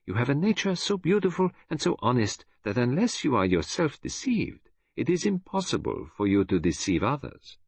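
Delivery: put-on high, lilting Belgian accent; Belgian detective lecturing. Detective explains to his friend how he was decoy, lilting accent. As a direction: explain with lively, swooping pitch.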